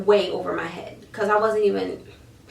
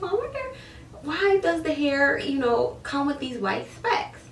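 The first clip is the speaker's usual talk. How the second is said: puzzled, much higher; 6 semitones higher than usual. Retold or imitated. Puzzled self-quote about hair specks.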